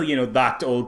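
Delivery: normal talking voice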